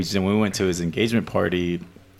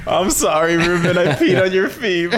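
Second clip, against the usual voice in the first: high, louder, laughing sorry; laughing apology; 6 semitones higher, 4 dB louder than usual. Retold or imitated. Mock apology to Ruben.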